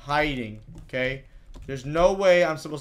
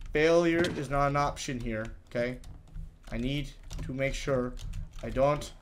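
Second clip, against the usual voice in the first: ordinary talk, nothing marked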